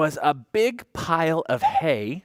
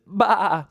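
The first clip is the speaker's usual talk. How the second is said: a loud bleating baa; sheep baa; 5 semitones higher, 5 dB louder than usual. Acted. Storyteller voices the sheep for the child. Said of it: "Sheep says".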